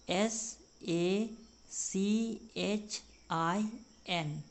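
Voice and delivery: each letter said slowly and apart; slow, letter by letter, for the class; spelling out slowly. Shown as a demonstration. Teacher spelling a name out slowly to students. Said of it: "हमारा spelling हो जाएगा".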